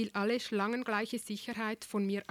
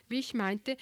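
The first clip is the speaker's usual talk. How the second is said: plain reading voice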